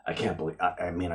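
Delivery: ordinary delivery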